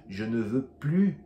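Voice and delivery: Je ne veux plus, loud and careful, plus without S; a negative plus with no S; silent S. Shown as a demonstration. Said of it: "em francês eu diria".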